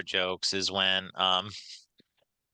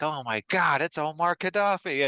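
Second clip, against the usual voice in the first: mock shocked gasp; 8 semitones higher than usual. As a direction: act out spotting him in the bar. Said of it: "and then he's like".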